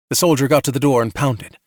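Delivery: ordinary delivery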